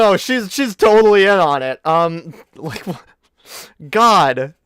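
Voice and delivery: ordinary talk, nothing marked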